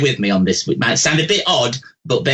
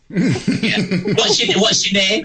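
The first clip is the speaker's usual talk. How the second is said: laughing through the question; 5 semitones higher, much slower than usual. Real, not acted. Psychic laughing as she asks caller's name, light laugh. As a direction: chuckle while asking her name.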